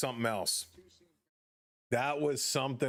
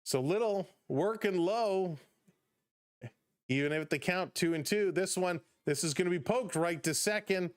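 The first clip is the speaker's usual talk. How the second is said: lifted, brisk ballgame call; play-by-play call; 4 semitones higher than usual. Acted. Streamer calling the Blue Jays game for chat, upbeat play-by-play. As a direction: call the innings like a radio announcer.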